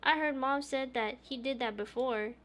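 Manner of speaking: put-on high loud insistent child voice; insistent little girl. Oya insists, mouth full.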